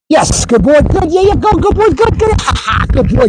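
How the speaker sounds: very high, rapid praise ending in a playful ha-ha; excited dog praise. Real, not acted. Trainer giving his dog a lot of excitement, excited praise. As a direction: whip the dog up with high cheering.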